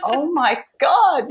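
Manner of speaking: high, slow, breathy amazement; awed, drawn-out oh my God; excited gasp. Retold or imitated. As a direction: gasp it out as if seeing something wonderful. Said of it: "very apprehensive, but also excited".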